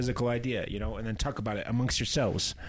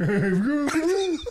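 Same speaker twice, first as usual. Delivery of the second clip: very high cartoon bear call; 6 dB louder than usual. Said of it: "sounds like Yogi the Bear".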